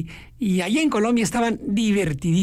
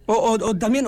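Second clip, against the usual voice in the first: normal talking voice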